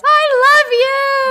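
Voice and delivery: high, slow tearful cry; mock sobbing declaration. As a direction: cry it out with love. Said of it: "And she just cries".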